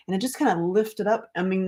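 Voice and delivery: ordinary talk, nothing marked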